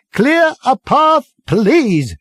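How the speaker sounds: high, loud, slow call; shouted. Acted. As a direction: stretch it out over the crowd. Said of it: "shouted Ford again".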